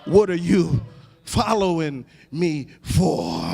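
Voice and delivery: ordinary delivery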